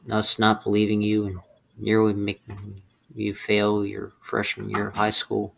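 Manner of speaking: normal talking voice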